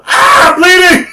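high, loud alarmed shout; panicked yell. Retold or imitated. Kid discovering his head wound, shouting in panic. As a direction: scream it as you see blood. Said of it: "I'm like, I look at".

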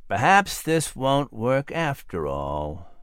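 slow, soft despairing thought. Acted. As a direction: let the hope drain out. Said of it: "starting to despair".

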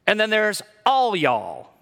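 "all y'all" with the first "all" leaned on; stress on all y'all. Shown as a demonstration. Pastor demonstrating Texan plural to the church, stressing all. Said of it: "The extra all is for emphasis".

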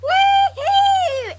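high, long-held "wee-hee" cheer; mimicked sitcom audience whoop. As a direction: imitate a studio audience's squeal. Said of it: "like those sitcoms where you hear".

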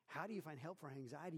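ordinary talk, nothing marked

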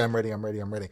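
ordinary delivery

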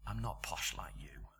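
whispered "I'm not posh like you", low and breathless; preacher whispering the woman's words to the congregation. Shown as a demonstration. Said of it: "whispered repeat of the line".

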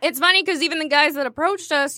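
usual speaking voice

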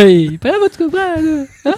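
high, sing-song imitation of the stall lady; mock vendor voice. Mimicking the vendor asking where their friend went, high and sing-song. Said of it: "repeats the vendor's question, mocking".

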